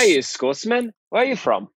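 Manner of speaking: put-on playful high Scottish accent. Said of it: "That's great. Like, A plus".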